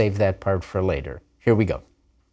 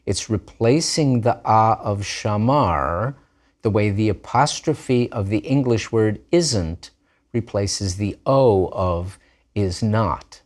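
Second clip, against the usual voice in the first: ordinary talk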